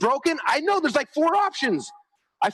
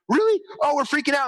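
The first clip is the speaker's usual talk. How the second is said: students hearing their funnel numbers are great, squealing excited disbelief; 6 semitones higher than usual. Retold or imitated. Pitch jumps way up, thrilled. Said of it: "they're like".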